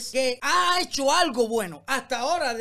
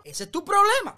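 higher and louder scold; scolding burst; 8 semitones higher, 5 dB louder than usual. Real, not acted. Vlogger scolding the singer over Cuba, loud scold. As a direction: snap it at him.

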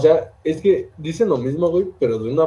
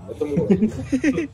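slow, high, laughing scolding of a little dog; mock scolding a puppy; 9 semitones higher, slower than usual. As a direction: tell a puppy to stay still, half laughing. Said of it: "¿Cómo lo regaña? Como si fuera perrito". (regañando a un perrito, agudo, lento y riéndose)

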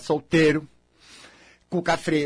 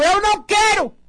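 higher, louder flat refusal; 14 semitones higher, 5 dB louder than usual. Real, not acted. Refusing the fan's autograph request, loud and high. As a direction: shout the refusal right back.

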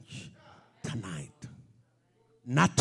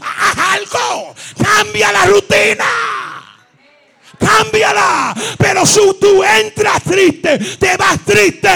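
much higher, much louder full-force preaching; preaching shout; 12 dB louder, slower than usual. Acted. The preacher shouting at the congregation to change, much louder. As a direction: drive the church to change.

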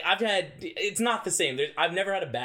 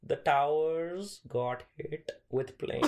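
slow news anchor; much slower than usual. Retold or imitated. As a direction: read it out slowly like dull news.

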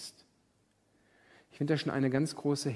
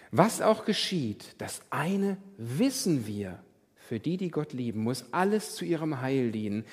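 scripture reading; 2 semitones higher, 5 dB louder than usual. Acted. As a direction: read it like Scripture, weighing each line. Reads scripture solemnly. (feierliche Bibellesung)

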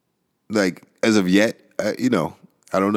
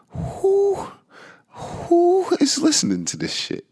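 pitched way up, mocking who listens; high squeaky aside; slower than usual. Host to listeners, squeaky mock disbelief.

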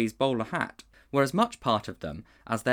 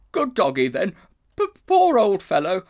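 stammering, louder, higher nervous plea; gasped; 10 semitones higher, 10 dB louder than usual. A narrator's reading. Frightened uncle gasping at the snarling bulldog. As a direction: gasp it out, shaking with fright. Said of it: "shaking hand and gasped".

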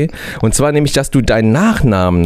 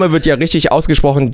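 usual speaking voice

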